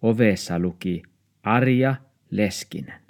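ordinary delivery, nothing marked

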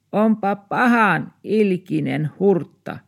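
high, slow indignant cry; old woman shouting. Acted. Old neighbour cries out at the dog baring teeth, high and slow. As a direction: cry out at the nasty mutt. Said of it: "Arjamuori huusi".

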